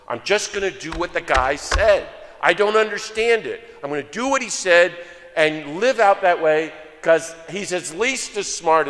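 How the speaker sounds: emphatic resolve. Retold or imitated. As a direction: declare it like a stubborn vow, imitating. Acting out Gandhi's bold resolve.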